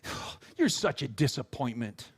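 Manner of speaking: deadpan put-down, dropped in pitch; imitated let-down sigh; disappointed parent. Mock parent rebuking fallen child.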